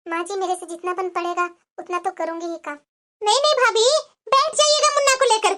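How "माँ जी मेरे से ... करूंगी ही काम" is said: meek, soft reply. Acted. Timid daughter-in-law answering mother-in-law.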